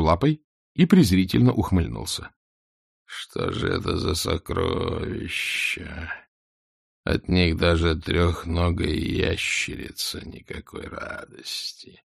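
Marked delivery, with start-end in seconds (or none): 3.1-12.0 slow, low, hushed scorn; scornful dragon. A narrator's reading. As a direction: sneer at the treasure, slow.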